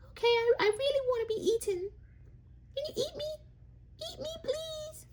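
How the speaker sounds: very high, fast then fading squeaky voice; food character voice. Acted. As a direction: beg to be eaten as a tiny vegetable. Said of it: "turn the food into characters".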